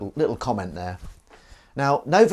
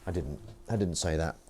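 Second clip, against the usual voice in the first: ordinary talk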